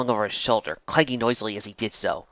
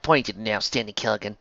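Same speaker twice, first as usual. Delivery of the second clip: ordinary delivery, nothing marked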